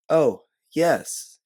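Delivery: a sweet Southern belle greeting; Southern accent. Pretend. You greet the policeman, putting on a Southern accent. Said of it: "throwing on your best Southern accent".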